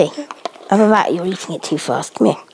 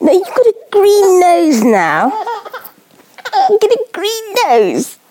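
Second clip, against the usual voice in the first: much higher sing-song, teasing through laughter; 11 semitones higher, 3 dB louder than usual. Real, not acted. Mother to baby with food on her nose, laughing baby talk.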